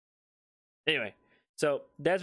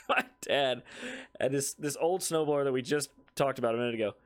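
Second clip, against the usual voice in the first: usual speaking voice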